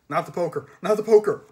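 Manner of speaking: loud plea climbing in pitch; panicked pleading. Acted. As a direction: beg in rising alarm. Boogeyman begs not to be hit.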